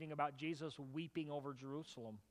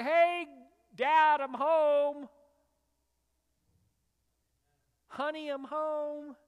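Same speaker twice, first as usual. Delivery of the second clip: much higher, louder, slow playful call; calling out home; 10 semitones higher, 13 dB louder, much slower than usual. Pretend. A child bursting into God's house, calling out loud. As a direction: call out happily through the front door.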